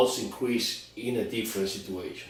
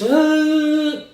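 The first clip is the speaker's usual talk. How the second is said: a sudden high, loud hammed-up wail of a guy chasing a girl; mock desperate cry; 9 dB louder than usual. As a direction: cry out like a needy chaser, overdone.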